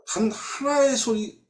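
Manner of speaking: high, slow, on the edge of tears; voice breaking. Real, not acted. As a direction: let the voice crack with real grief. Preacher moved by David's despair.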